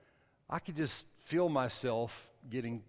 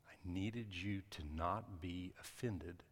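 voice of the Holy Spirit; 7 dB quieter than usual. Retold or imitated. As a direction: speak it softly and deliberately, as God's quiet word. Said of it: "I heard the Holy Spirit say".

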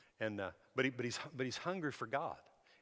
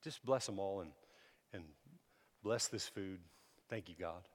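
softer, lower, halting prayer; simple humble prayer; 5 semitones lower, 7 dB quieter than usual. Retold or imitated. New believer finishing grace at table, humble and quiet. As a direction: murmur an unpolished blessing with pauses. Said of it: "And he, and he just said".

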